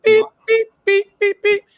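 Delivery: high loud beeps like a phone line; beep imitation. Recalling the old conference call, imitating beep tones.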